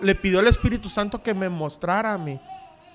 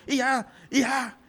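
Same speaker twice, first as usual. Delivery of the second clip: a father's shout, voiced higher; retold yelling; 4 semitones higher, 4 dB quieter than usual. Father yelling at his son over a soldering mistake, shout. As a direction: bark it like the angry dad. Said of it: "con cada grito".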